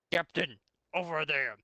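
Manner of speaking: a trooper calls out the alarm; trooper yelling. A narrator's reading. Trooper spots something, calls out to his captain, loud and urgent. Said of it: "yelled one of his troopers".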